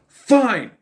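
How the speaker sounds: high, loud burst of giving in; exasperated exclamation. Acted. Playing out the film's plan after objections, exasperated exclamation.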